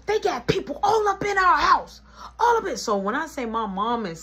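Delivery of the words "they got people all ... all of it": furious mom. Retold or imitated. Mom snapping.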